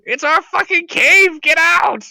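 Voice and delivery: high, loud territorial screech; screaming kobold. Acted. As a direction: yell like an outraged little kobold. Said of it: "they're all screaming".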